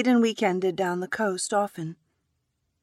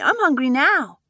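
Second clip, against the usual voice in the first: put-on child voice; 8 semitones higher, 7 dB louder than usual. Young daughter speaking.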